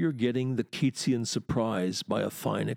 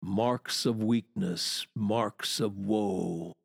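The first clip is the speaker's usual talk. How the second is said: slow, every syllable countable; spaced-out syllables, lingering on the last word; measured verse recital; 2 semitones lower, slower than usual. Shown as a demonstration. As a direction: recite it slowly, stretching WOE. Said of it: "count the syllables in that last line".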